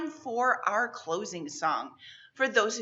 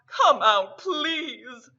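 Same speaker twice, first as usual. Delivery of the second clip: begging; 3 semitones higher, 7 dB louder, much slower than usual. A narrator's reading. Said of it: "dropped to his knobby knees and begged".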